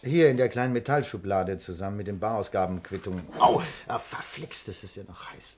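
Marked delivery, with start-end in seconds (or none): 3.4-5.6 pained yelp. Acted. Touches hot metal drawer.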